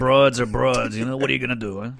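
New York guy uncle impression; classic uncle. Host playing the classic uncle excusing women, New York guy voice. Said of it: "classic uncle is like".

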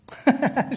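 high, loud burst of laughter; real amused laugh. Real, not acted. Speaker teasing a listener who rolls her eyes, amused laugh.

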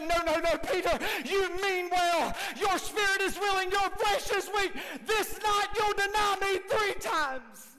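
high, loud solemn warning; Jesus warning. Acted. Jesus warning Peter he will deny him. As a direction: foretell the denial forcefully.

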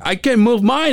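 mock panic. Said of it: "He was like".

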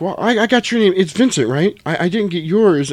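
high, stammering mock nerves; imitating nervous Brett. Brett makes a nervous excuse to Jules, high and stammering. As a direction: squeak out the excuse. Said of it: "Brett starts trying to make an excuse".

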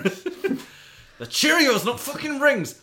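loud laughing scold; scolding shout. Acted. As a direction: snap at him over the cereal. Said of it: "telling him off".

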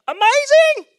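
very high, loud, stretched-out cry of delight; over-the-top hype; exuberant exclamation. Real, not acted. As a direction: belt it out slowly, sky-high. Hyping the church's attitude.

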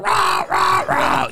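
shouted creature roars; Chewbacca growls. Acted. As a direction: growl out a mock Wookiee roar. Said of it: "when Chewbacca growls, when he goes".

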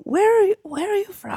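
high-pitched, baffled asking; puzzled questioning. Acted. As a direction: ask as if thrown by a strange accent. Acts out puzzled reaction to accent.

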